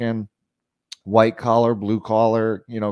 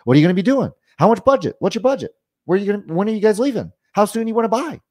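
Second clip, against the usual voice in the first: high, loud, in-their-face questioning; pushy salesperson badgering a customer; constant pressure; 7 semitones higher, 5 dB louder, much faster than usual. Shown as a demonstration. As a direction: fire questions without letup. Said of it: "constant pressure in their face".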